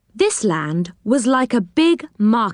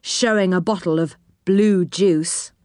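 usual speaking voice

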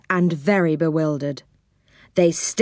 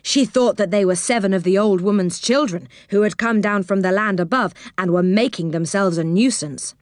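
ordinary delivery, nothing marked

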